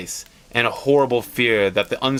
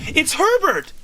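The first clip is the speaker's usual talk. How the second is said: scream. Acted. Mother hearing her dead son knock, screaming. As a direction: shriek the name in wild hope. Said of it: "she screamed".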